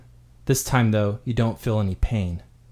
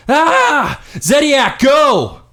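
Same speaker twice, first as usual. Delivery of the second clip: much higher, much louder desperate scream; 14 semitones higher, 9 dB louder, much slower than usual. Acted. Brother screaming at Zediak to run, full loud scream. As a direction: scream it out to save your brother. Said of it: "crawl out from the bed screaming".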